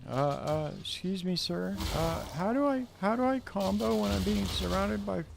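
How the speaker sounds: high, meek squeaky voice; timid worker voice. Pretend. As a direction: ask meekly like a scared employee. Meek worker asks the boss.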